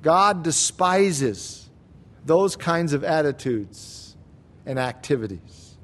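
earnest pastoral warning, slowing down; solemn emphasis. Real, not acted. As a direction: let each word land heavily. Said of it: "Beloved, please hear this".

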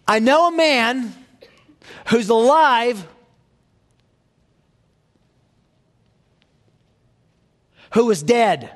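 high, loud, ringing cry with a laugh; triumphant proclamation. Acted. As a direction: shout the good news with joy.

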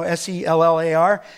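"S E L L A R" spelled letter by letter; spelling "cellar" so nobody hears "seller". Shown as a demonstration. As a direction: spell "cellar" out loud, one letter at a time. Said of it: "not, you know".

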